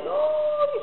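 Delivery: very high, startled "No"; the people falling back at Moses shining, in amazement; astonished cry. Retold or imitated. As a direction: gasp it out in awe.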